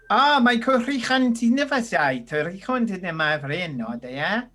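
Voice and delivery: hearty burst of invented Gnomish; tiefling speaking Gnomish. Acted. Tiefling answers overheard Elvish in Gnomish, hearty foreign-tongue greeting. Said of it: "tiefling walks out ... catches the last snippet of Elvish that you say and goes".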